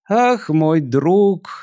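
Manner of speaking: slow, slurring tipsy sigh; slurred drunk speech. Acted. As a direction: drag the words out drunkenly. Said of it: "заплетающимся языком выговорил пришелец".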